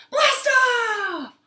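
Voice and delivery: high, loud, drawn-out cheer; launch shout. Real, not acted. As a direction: shout it like a rocket taking off.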